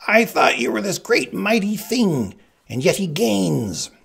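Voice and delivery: high, loud berating from the Sicilian; angry shouting. A narrator's reading. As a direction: shout the accusation, pitched high. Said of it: "the Sicilian shouted".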